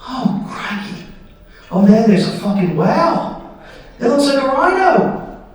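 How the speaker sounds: hushed then rising mock Aussie wonder; awed Australian. Aussie daredevil spotting a whale, hushed then excited accent. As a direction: breathe the crikey, then marvel. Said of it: "he just over there goes".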